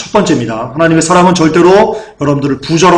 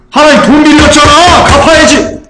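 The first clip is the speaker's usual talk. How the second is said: higher, louder mock demand; mock demanding creditor; 8 semitones higher, 4 dB louder than usual. Preacher mimicking a believer who treats God as a debtor, mock demanding. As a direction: ham up a greedy believer demanding his due.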